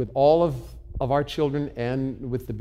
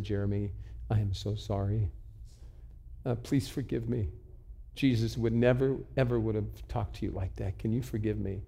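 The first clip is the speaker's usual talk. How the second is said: soft, subdued plea for forgiveness; retold tender apology; 2 semitones lower, 6 dB quieter than usual. Retold bedside apology to son.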